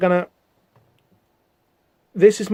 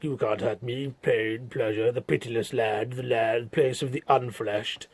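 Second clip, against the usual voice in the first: low, much softer, menacing demon voice; Orlando's cold demon threat, much softer and lower; Pinhead-style demon; 5 semitones lower, 10 dB quieter, slower than usual. Acted. Demon taunting Fanny, soft and menacing. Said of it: "more brilliant Pinhead dialogue".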